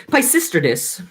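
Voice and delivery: ordinary delivery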